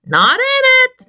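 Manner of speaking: squeaky high "Not in it"; high playful. Acted. Teacher jokes for children in a squeak. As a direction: pop up high.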